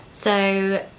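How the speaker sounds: ordinary delivery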